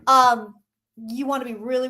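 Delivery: usual speaking voice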